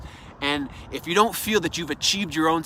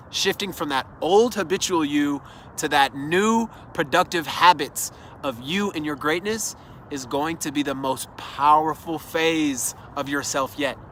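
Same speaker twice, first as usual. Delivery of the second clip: ordinary delivery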